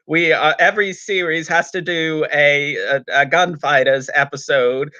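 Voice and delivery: hammed-up bad American accent; Peter Purves. Host introducing a gunfighters episode in a put-on cowboy American accent. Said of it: "as Peter Purves doing an American accent".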